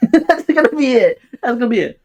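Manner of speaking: giggling through it, still high and loud; real laugh spilling over the words; amused at her own joke. Real, not acted. Laughing after her mock tag.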